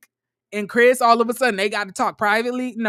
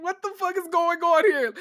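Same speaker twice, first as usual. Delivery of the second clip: high-pitched mock bewilderment; baffled reaction; 9 semitones higher than usual. Imitating two women watching her break down, baffled. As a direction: act out baffled disbelief, pitched up. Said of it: "looking at her like".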